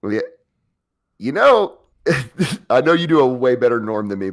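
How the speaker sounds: normal talking voice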